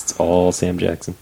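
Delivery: ordinary talk, nothing marked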